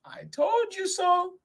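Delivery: very high little mouse voice; high-pitched, knowing little creature; smug mouse. Acted. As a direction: squeak it as proof. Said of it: "said Mouse".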